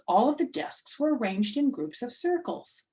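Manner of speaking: plain reading voice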